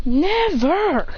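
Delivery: high, stretched-out mock defiance; hammed-up defiant outburst; emphatic refusal. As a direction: refuse dramatically, pitched high. Emphatic refusal to help villain.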